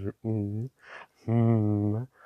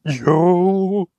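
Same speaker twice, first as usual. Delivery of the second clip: loud, high yo; a loud thrilled yo; excited shout; 8 semitones higher, 11 dB louder than usual. Real, not acted. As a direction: shout with real delight. Excited at the swatch.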